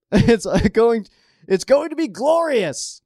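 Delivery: high, excited, almost squealing; gleeful excitement. Real, not acted. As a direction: relish the rivalry. Gleeful about coming rivalry game.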